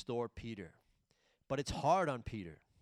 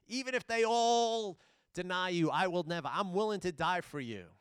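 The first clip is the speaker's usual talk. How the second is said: raised, louder sermon voice with Jesus' question pressed hard; fervent preaching; 5 semitones higher, 4 dB louder than usual. Acted. Preacher to congregation, retelling Jesus asking Peter, raised and fervent. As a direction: preach it out, pushing the love question.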